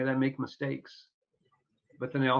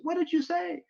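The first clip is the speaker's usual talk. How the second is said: curious question. Retold or imitated. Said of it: "And everybody said".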